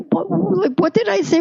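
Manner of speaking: high, puzzled what did I say; bewildered question. Retold or imitated. She, laughed at by French coworkers, asks in high confusion. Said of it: "I said".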